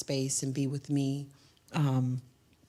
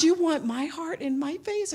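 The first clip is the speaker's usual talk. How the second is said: much higher, playful disbelief; mock disbelief; 13 semitones higher than usual. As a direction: throw it back at her, high and teasing. Teasing reply to the lady.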